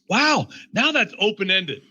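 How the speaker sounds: high, punched "Wow"; mock amazement. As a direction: exclaim it as if delighted by a discovery. Acting out delighted discovery.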